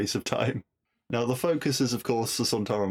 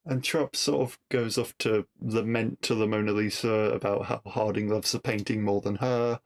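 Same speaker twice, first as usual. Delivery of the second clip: ordinary talk, nothing marked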